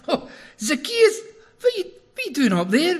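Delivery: high, loud, laughing jeer; mocking crowd. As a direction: tease him like a crowd that's caught him out. Crowd jeers at man in tree.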